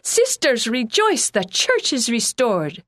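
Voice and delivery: put-on high, loud, joyful Russian accent. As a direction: exclaim it with glee in a Russian accent. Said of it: "exclaimed with joy in his Russian accent".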